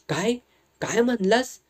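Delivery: a shaky, high disbelieving question; trembling voice. A narrator's reading. Old grandmother hearing of her grandson, shaky. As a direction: ask it with a quiver, unable to believe. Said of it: "कापऱ्या आवाजात विचारलं".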